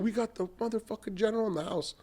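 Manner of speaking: high hyped-up radio DJ shout-out; radio hype announcer. Retold or imitated. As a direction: announce him like a DJ hyping the crowd. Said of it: "shot me on the radio and said".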